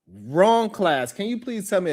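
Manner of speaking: ordinary talk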